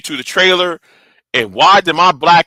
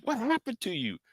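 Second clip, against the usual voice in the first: hushed, higher voice for the uncle; imitated concerned uncle; 6 semitones higher, 18 dB quieter than usual. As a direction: play the uncle asking very softly. Repeats uncle's question in voice.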